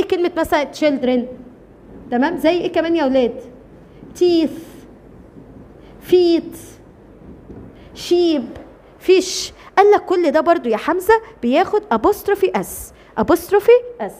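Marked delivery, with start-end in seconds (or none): none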